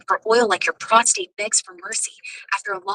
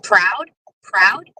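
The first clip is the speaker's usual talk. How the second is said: incredulous loud repeat; 4 semitones lower, 6 dB louder than usual. Real, not acted.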